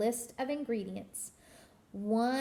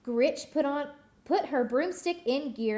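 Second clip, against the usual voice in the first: plain reading voice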